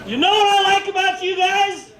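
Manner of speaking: high, sustained shout to the crowd; yelled call. Pretend. Speaker cueing the audience to answer, yelling. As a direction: holler it to get a group reply. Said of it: "I'm gonna yell out".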